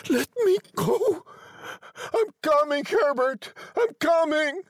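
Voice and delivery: frantic, high-pitched calling out; struggling cry. A narrator's reading. Mother struggling free to reach her son, frantic cry. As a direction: call to the son high and desperate. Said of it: "she cried, struggling".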